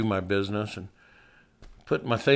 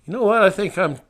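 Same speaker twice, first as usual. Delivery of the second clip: higher, louder mock exclamation; raised resigned outburst; comic surrender; 9 semitones higher, 7 dB louder than usual. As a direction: throw up your hands in the quote.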